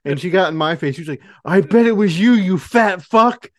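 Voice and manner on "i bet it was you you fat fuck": loud, high, slow drill sergeant yell. Retold or imitated. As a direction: get in their face and bark. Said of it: "like a drill sergeant".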